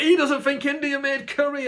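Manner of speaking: very high, loud mock-scandalised troll; hammed-up scandalised troll; outraged troll. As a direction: squeal it like a shocked commenter. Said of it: "they're like".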